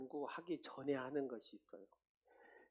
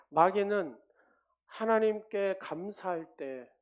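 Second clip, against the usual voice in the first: higher, much louder and slow; preaching shout; 6 semitones higher, 10 dB louder, slower than usual. Real, not acted. Pastor thunders to the church about giving thanks, loud and slow. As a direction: drive the point home at full force.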